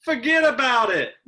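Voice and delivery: tossing off a hammed-up mobster line; New York Italian accent. Host joking about failed pizza, mock New York accent. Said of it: "What accent or what".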